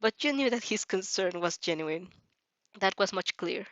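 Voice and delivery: ordinary delivery, nothing marked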